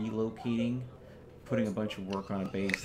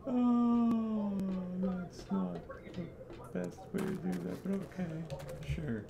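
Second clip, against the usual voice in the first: high, slow, drawn-out disbelief; exasperated but letting it go; unimpressed; 11 semitones higher, much slower than usual. Real, not acted. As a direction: let it sink in that this is a bad idea. Unimpressed at teammate's frame build.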